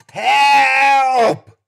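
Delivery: high, loud scream for help; desperate scream. A narrator's reading. Injured hiker screaming to the searchers, desperate. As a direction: scream with everything you have. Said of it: "I screamed into the air".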